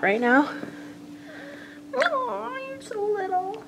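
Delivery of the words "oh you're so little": much higher and softer coo. Real, not acted. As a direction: coo at the little cat. Cooing at a tiny cat.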